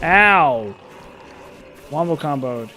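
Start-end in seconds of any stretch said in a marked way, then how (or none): 0.0-0.7 loud, drawn-out "ow"; pained yelp. Real, not acted. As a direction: yelp as if just hit in the game. Reacts to getting hit in game.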